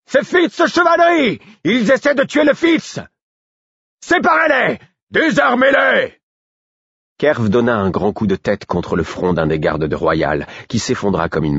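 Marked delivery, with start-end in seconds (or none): 0.1-6.2 bawling. A narrator's reading. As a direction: bellow over your shoulder at the guards. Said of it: "il brailla".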